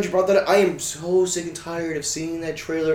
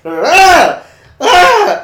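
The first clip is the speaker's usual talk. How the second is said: loud high wordless yell; 14 dB louder than usual. Real, not acted. Yelling for fun among friends, high scream. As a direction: let out a joyful scream. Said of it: "I don't know why I'm yelling".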